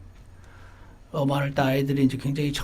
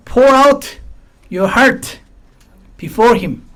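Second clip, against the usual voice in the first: emphatic proclamation; 6 semitones higher, 6 dB louder, much slower than usual. Shown as a demonstration. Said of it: "그분 앞에 너의 마음을 쏟아놓아".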